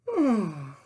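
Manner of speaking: loud yawning sigh. A narrator's reading. Sandwich yawns loudly.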